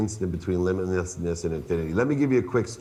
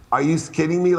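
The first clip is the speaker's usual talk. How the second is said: mock disbelief; 7 semitones higher, 5 dB louder, faster than usual. Mocking the trillion-dollar claim.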